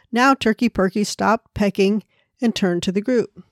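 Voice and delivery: ordinary delivery, nothing marked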